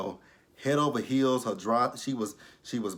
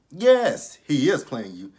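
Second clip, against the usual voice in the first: Yes! high and loud, then insist; exasperated shout; 4 semitones higher, 7 dB louder, slower than usual. Real, not acted. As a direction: yell it at the screen. Yelling at the TV.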